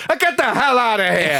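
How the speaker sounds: high-pitched mock outburst of temper; hammed-up indignant dismissal; Mean Gene. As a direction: imitate an announcer losing his rag. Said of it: "loses his fucking temper".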